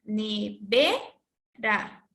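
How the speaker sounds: slow Ne-VE-ra, swooping up on VE; stress on second syllable. Shown as a demonstration. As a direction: chant Nevera in separate syllables, leaning hard on the middle one. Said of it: "lo hacemos de la siguiente manera".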